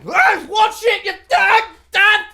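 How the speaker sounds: high, slow furious yelling; angry shout. Acted. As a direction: scream it at the clumsy oaf. Sailor banged against ship.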